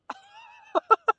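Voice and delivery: high, loud burst of laughter; big laugh. Real, not acted. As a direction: let out a big cackling laugh. Laughing at her own joke.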